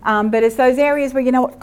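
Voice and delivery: ordinary delivery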